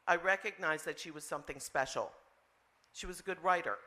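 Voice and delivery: usual speaking voice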